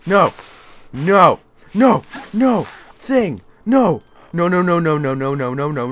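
loud, high cries tumbling into a rapid string; panicked refusal. Real, not acted. Scared player refusing what the game shows, panicked yelps. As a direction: yelp each refusal, then let them run together.

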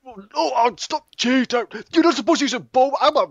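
very high, loud comic squeal; hammed-up protest. Fighter accusing rival of cheating, hammed-up squealed protest. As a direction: squeal your complaint as if under attack. Acting being shot with bow.

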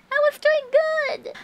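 high-pitched whine; wailing lament. Real, not acted. Streamer lamenting a lost good run, high whine.

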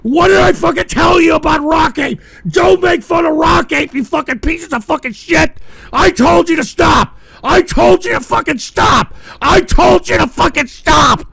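high, loud furious rant; furious shouting. Real, not acted. Streamer yelling at viewers who mocked Rock Ape, furious shouting. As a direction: scream at the viewers in rage.

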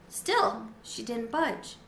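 usual speaking voice

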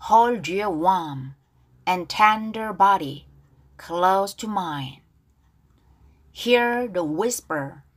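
slow, careful English line reading; unhurried read-along for learners; pronunciation model. Shown as a demonstration. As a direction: model each phrase slowly, pausing between phrases. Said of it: "자, 발음 다시 한번 해볼까요?".

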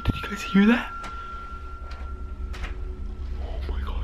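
hushed, voiceless whisper; frightened whisper. Real, not acted. Vlogger asking viewers about a noise, frightened whisper. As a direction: whisper it, afraid of being heard.

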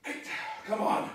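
loud grunt of effort mid-lift; effortful shout. Real, not acted. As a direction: push it out hard through the strain. Pushing through final heavy reps.

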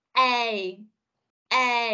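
A said as a glide from e to i; diphthong demo. Shown as a demonstration. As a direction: model the A sound, e sliding into i. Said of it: "Thì mình sẽ phát âm là".

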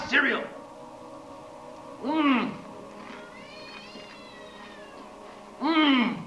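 chewing with closed lips; humming while chewing food; mouth full, chewing. Real, not acted. Seen 0: Closed pursed lips moving after hand-to-mouth eating; cereal on table.

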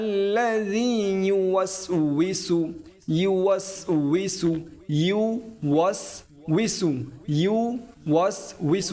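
يوسوس spelled out slowly; slow careful. Shown as a demonstration. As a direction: drag each sound of يوسوس. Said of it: "আমরা অনেকে উচ্চারণ করতে পারি না".

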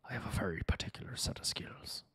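hushed Taken line; whispered movie quote. Pretend. Streamer whispering a movie threat to chat.

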